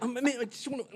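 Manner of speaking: put-on high, whiny man-child whimper; childish whining. Stranger whines like a child while kicking his luggage. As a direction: whimper like a toddler in a tantrum. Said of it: "And he kept".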